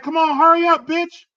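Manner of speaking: put-on high, loud kid yelling at a friend; a little kid hollering across the street; kid's yell. Kid cussing out a friend in the street, high and loud. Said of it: "the little kid was like".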